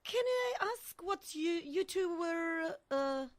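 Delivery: hesitant question. Acted. Wife questioning the two men back from digging, hesitant. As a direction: ask haltingly, tailing into an uh. Acted awkward question in story.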